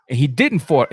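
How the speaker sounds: ordinary talk, nothing marked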